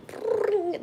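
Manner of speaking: high soft mock ding; a quiet high ding of magic; magic chime. Drink appearing by magic, soft high chime. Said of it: "like that".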